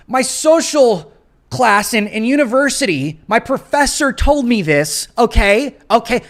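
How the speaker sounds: high, loud, slowed mocking voice of a preachy liberal. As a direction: ham up a condescending college know-it-all.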